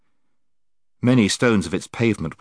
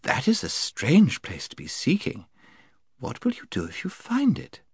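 high, soft, sweet lady; honeyed voice; 6 semitones higher, 4 dB quieter than usual. Acted. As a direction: coax them gently, sweetly. Lady's sweet questioning voice.

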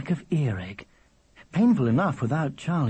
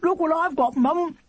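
put-on high child talking through chocolate; mouth full; 4 dB louder than usual. A narrator's reading. Charlie showing Mum his chocolate, mouth full. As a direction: call out excitedly round a mouthful. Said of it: "with his mouth full".

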